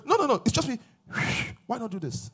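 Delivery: ordinary talk, nothing marked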